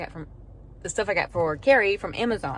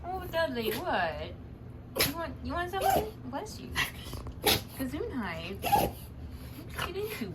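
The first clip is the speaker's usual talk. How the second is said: cooing pet talk; 12 semitones higher than usual. Real, not acted. Cooing to the whining dog.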